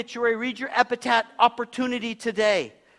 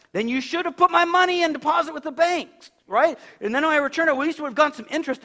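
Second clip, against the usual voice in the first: master's rebuke, higher and louder; stern master; 7 semitones higher, 5 dB louder than usual. Acted. Master rebuking the lazy servant, higher and louder. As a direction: scold him like an angry master.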